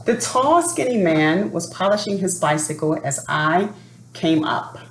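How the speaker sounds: plain reading voice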